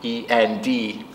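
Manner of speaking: slow, letter by letter; spelling out. Shown as a demonstration. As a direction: spell each letter for the child. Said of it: "that spells end".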